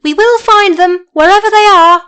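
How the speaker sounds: high, loud eager vow; Winkie promise. Acted. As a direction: promise it heartily.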